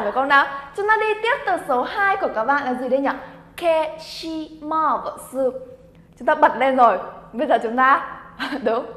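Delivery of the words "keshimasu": "Keshimasu" drawn out very slowly, each syllable apart. Shown as a demonstration. Teacher introducing word number two to learners, syllable by syllable. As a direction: stretch every syllable of "Keshimasu".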